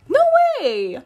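normal talking voice

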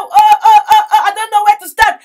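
loud laughing cry of delight; overwhelmed with joy, shouting through laughter; laughing joyful shout. Real, not acted. Overjoyed showing virtuous women.

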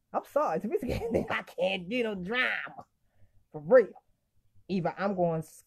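usual speaking voice

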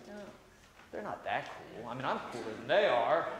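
put-on dismissive jealous kid. Mocking jealous kid reply.

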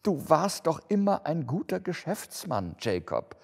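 high, uneasy appeal; stammering. A narrator's reading. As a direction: timidly placate an old partner, dropping on the name. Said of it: "stammelte Scrooge". (verunsichert, mit erhöhter Stimme)

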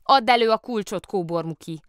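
put-on loud, insistent demand from a child; commanding. A narrator's reading. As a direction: demand the key firmly, starting loud and high. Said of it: "parancsolta Lotta".

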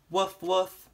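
read-aloud puppy barking; dog bark. Acted. Dog barks in picture book.

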